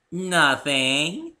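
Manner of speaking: high, loud singsong teasing; playful Pinkie tease. A narrator's reading. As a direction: tease him with a coy nothing. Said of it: "said Pinkie teasingly".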